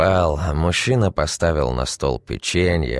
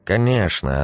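plain reading voice

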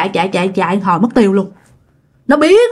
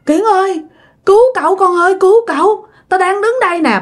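high-pitched frightened cry for help; acted panic over the phone; trembling, urgent plea; 8 semitones higher than usual. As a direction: beg to be rescued, voice raised in pitch. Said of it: "cái giọng run run".

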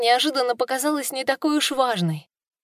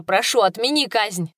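ordinary delivery, nothing marked

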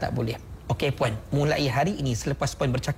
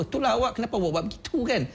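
quick, high-pitched mock telling-off; scolding husband; 8 semitones higher, much faster than usual. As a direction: blame your wife in a rush, acted. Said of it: "isteri pula kena marah dengan suami dia".

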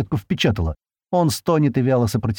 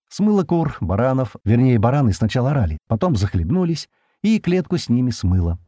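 plain reading voice

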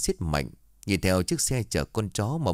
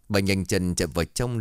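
usual speaking voice

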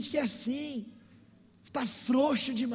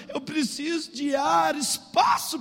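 higher-pitched teenage complaint, imitated; acted exasperated plea; 6 semitones higher, 4 dB louder, slower than usual. Girl complaining to pastor about her mother, acted exasperation. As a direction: plead for room to breathe, mock-desperate.

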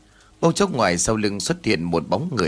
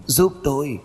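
high, thin ghostly plea; a dead girl's shrill wail; 4 semitones higher than usual. A narrator's reading. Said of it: "Giọng của Linh thè thé phát ra".

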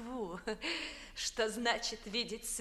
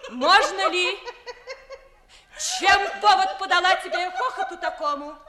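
loud, high-pitched reproach; indignant outburst; 6 semitones higher, 8 dB louder than usual. Acted. Mistress rebukes her maid for laughing, indignant and loud. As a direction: raise the voice sharply, offended at the laughter.

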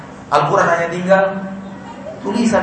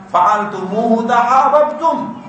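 slow, careful "fa'altumuhu tahabbtum"; slow Arabic quote; 5 semitones higher, much slower than usual. Shown as a demonstration. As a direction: recite the hadith slowly. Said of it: "dikatakan Nabi". (kutipan hadis pelan)

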